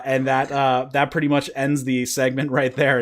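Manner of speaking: usual speaking voice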